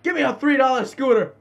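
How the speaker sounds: demanding shout. Pretend. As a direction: yell it like a playful carjacker. Demanding a vehicle in game.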